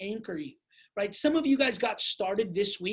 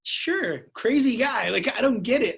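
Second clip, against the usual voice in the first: louder, mock agreeing with a wink; sarcastic agreement; 2 semitones higher, 6 dB louder than usual. Agreeing with the teller about the crazy guy, sarcastic. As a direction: play along while rolling your eyes.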